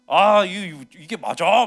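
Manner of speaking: loud, stammering grumble at the city; 이게 맞아 하고 크게 투덜대듯; irritated mock complaint. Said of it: "막 이러면서".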